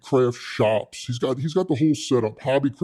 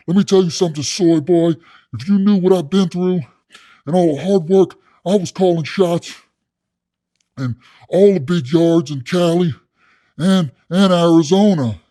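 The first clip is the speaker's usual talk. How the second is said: loud, raised tough-inmate bluster; boastful cellmate; 5 semitones higher, 6 dB louder than usual. Retold or imitated. Inmate boasting to soy boy of hard time, blustering. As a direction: brag forcefully as the big shot.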